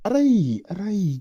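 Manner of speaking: sharp, high, loud yelps of pretend pain; exaggerated playful ouch; mock ouch. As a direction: yelp as if pinched, hamming it up. Getting hit for the joke.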